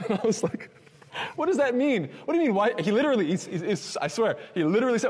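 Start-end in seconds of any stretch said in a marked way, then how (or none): 1.4-2.7 high, loud incredulous questions; acted-out flabbergasted retort; exasperated disbelief. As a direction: fire back in baffled protest. Baffled reply to friend's blame.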